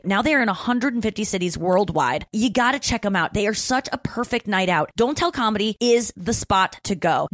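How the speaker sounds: soft, upbeat sponsor read. Acted. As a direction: read the ad softly and brightly. Host reads sponsor ad.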